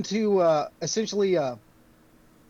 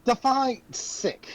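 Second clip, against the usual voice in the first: slow, higher-pitched query; probing, sceptical; 7 semitones higher, much slower than usual. Acted. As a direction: draw it out, asking for specifics. Druid questions the vague description.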